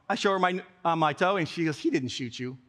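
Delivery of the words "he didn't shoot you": low, quiet brush-off; dismissive. Retold or imitated. Mom to her complaining son, dismissive. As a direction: drop it low and soft, unconvinced.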